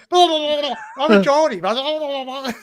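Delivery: mock Geordie. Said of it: "He says".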